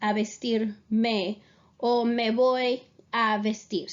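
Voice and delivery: slow and careful, each word clear; teacher modelling a sentence slowly; slow model sentence. Shown as a demonstration. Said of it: "I would say".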